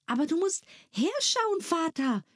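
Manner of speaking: little daughter pleading for attention; pleading girl. Acted. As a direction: coax Father to come and look. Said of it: "bat Nella Della".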